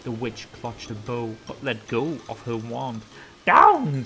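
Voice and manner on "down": loud high cry of Down; shouting. Acted. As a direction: call it out in alarm. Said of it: "cried the witch".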